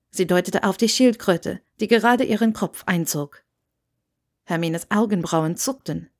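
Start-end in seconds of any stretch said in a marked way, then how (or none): none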